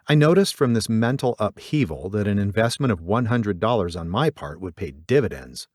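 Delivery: plain reading voice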